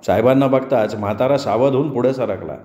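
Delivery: ordinary delivery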